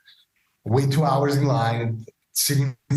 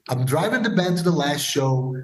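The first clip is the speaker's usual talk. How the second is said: ordinary delivery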